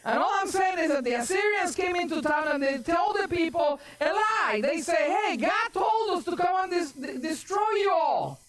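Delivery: high-pitched, driving sermon delivery; forceful preaching. Acted. Preacher retells the enemy's threat to God's people, forceful preaching.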